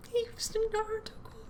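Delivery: a silly high cartoon aside; squeaky joke voice. Joking aside about the line.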